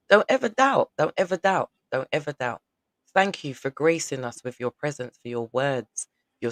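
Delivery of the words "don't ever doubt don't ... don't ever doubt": emphatic urging. Real, not acted. Host urges guest, repeating.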